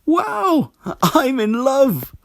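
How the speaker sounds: high, loud, slow joyful cry; squeaky overjoyed frog voice; delighted. Acted. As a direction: burst out in giddy delight. Frog delighted after hearing diagnosis.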